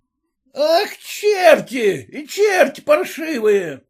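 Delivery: high, drawn-out cursing cry; shouted curse. A narrator's reading. Red-faced peasant cursing them again, high shout. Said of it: "опять крикнул".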